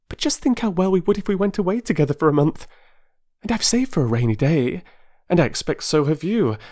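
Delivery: high, rushing, pleading voice of an excitable wife; eager Mrs Wilkins. A narrator's reading. Mrs Wilkins urging a stranger to escape, eager and high.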